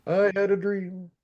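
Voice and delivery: teasing, stretched-out proclamation; mock-grand echo. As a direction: intone it like a famous speech. Echoes speaker's line as grand quote.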